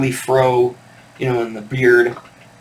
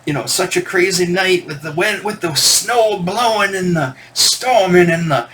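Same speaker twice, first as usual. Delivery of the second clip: preacher voice; 6 semitones higher than usual. Retold or imitated. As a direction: paint the storm like a sermon. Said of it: "he talked like a preacher almost".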